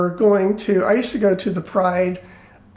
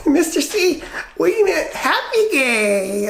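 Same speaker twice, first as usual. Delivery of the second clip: put-on child's excuse; 7 semitones higher, slower than usual.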